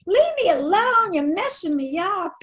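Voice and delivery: hammed-up shriek of a harassed woman; a pestered woman's mock scream; retold scream. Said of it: "start screaming".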